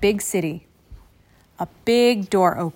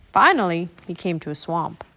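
ordinary delivery, nothing marked

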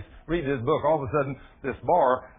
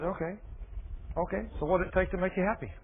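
softer, quick willing okay; soft giving in; 3 semitones higher, 7 dB quieter than usual. Retold or imitated. Preacher softly offering to make the man happy. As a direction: yield with a gentle shrug. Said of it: "I said".

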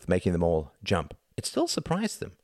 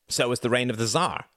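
mildly snappish retort, higher pitch; snapped; 6 semitones higher than usual. A narrator's reading. Reginald retorting to Tom, snapping mildly. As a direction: snap back with a touch of irritation. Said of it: "snapped Reginald".

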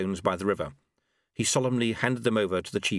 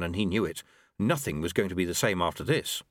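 ordinary delivery, nothing marked

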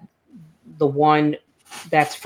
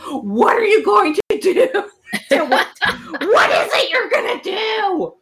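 much higher and louder, laughing, half crying; retold scream; 4 dB louder than usual. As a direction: shriek the questions in giddy desperation. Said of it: "start screaming".

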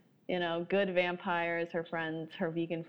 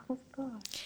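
whisper; 6 semitones higher, 7 dB quieter than usual. Retold or imitated. Retelling her own reaction.

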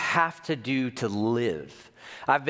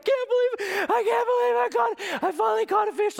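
much higher, squealing with disbelief; joyful disbelief; 3 dB louder than usual. Retold or imitated. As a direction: squeal it like you finally caught the fish. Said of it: "I'm like".

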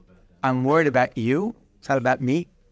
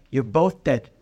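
ordinary talk, nothing marked

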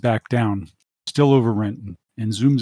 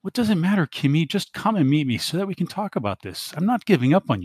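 whining; 7 semitones higher than usual. Acted. Dante pleading on comm.

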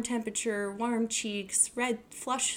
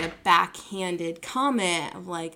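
ordinary talk, nothing marked

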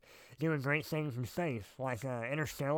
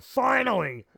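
high, loud relieved outburst; talking dog; 9 semitones higher, 7 dB louder, slower than usual. Acted. Skip the dog starts talking in space, loud high exclamation. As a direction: burst out like a dog finally able to talk. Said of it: "He'd be like".

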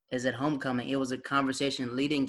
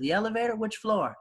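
high, pointed questions; surprised questioning; 8 semitones higher than usual. Retold or imitated. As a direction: press him suspiciously. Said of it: "He was like".

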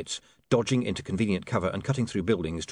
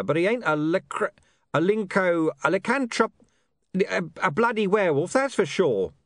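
high, loud, fumbling the long word; insist, stumbling over lycanthrope; 12 semitones higher, 5 dB louder than usual. A narrator's reading. Deep Bone rants about Nobbs.

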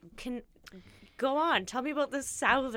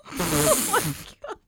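exasperated; 9 dB louder, much slower than usual. Real, not acted. Exasperated at endless pronunciation debate.

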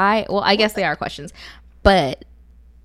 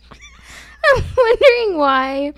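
laughing; 5 dB louder, much slower than usual. Real, not acted. Slow laughing.

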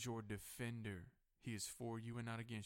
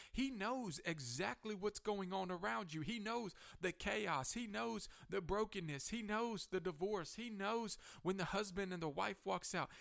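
high, louder preaching cry, hammering each refrain; full-force preaching; 9 semitones higher, 5 dB louder than usual. Real, not acted. Pastor assures congregation that God knows their storms, impassioned preaching. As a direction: preach it out in rising, driving waves.

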